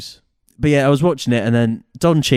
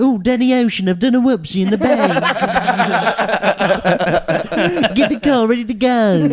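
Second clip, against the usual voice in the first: much higher, hammed-up bad Cockney accent; Don Cheadle's bad Cockney; 10 semitones higher than usual. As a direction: overdo a clumsy Cockney accent, giggling through it. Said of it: "trying to do a Cockney accent".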